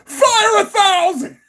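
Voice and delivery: hammed-up high-pitched whimper on Fire a thousand; squealed mock wail. Shown as a demonstration. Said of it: "repeats the quote twice".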